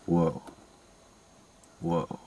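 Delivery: low, soft actor impression; Keanu Reeves. Imitating the cameraman in the film, low and soft. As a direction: drop low and quiet, mock-dazed monosyllable. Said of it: "cameraman was just Keanu Reeves".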